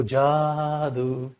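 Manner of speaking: slow, lingering जादू; drawn-out magic word. Shown as a demonstration. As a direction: stretch जादू out very long, especially the first vowel. Teacher teasing a magic trick.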